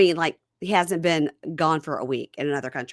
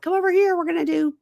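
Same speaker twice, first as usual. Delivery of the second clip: put-on much higher, sing-song call to kids; cheery crew member; 9 semitones higher than usual. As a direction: lure the children over brightly. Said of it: "a production crew or whatever was like".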